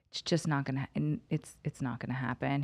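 low, soft and resigned; subdued bad news. Friend off the phone with the prison, quietly breaking bad news. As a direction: deliver the letdown quietly and low.

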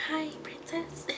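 a light imitation of a sweet teacher. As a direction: gently tell a kid their fly is down. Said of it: "she's so sweet".

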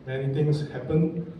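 normal talking voice

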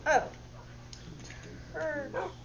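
ordinary talk, nothing marked

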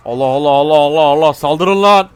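loud charging war chant, rising at the end; battle cry. Pretend. Player rallying troops to attack, rising battle cry.

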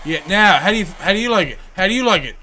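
loud taunt. Real, not acted. Taunting the opponent mid-punch.

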